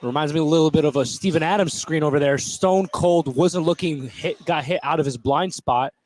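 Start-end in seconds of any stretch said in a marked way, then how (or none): none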